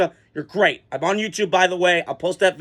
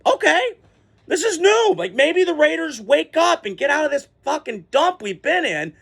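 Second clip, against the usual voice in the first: high excited inner monologue, much higher; hopeful inner voice; 8 semitones higher than usual. Retold or imitated. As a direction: voice a fan's sudden hope, pitched way up. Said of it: "I'm sitting in my head going".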